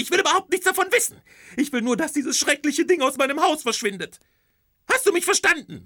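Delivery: very high, loud and fast tirade; wife ordering the skeleton out of the house, very high, loud and fast; furious wife. Acted. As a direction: rattle off the order, beside yourself. Wife's shrill outrage.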